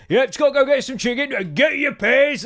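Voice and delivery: mock car boot lad; hammed-up broad bloke; imitating the son. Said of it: "they talk exactly the same".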